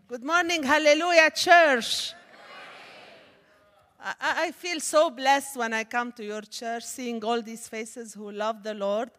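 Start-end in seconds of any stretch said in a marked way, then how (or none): none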